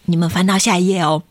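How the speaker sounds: plain reading voice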